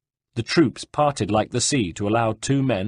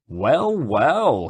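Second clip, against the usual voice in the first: swooping, higher-pitched greeting; mocking surprise; 4 semitones higher than usual. A narrator's reading. Horseman spots Ezio at Caterina's side, mocking surprise. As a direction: draw it out as a gloating discovery.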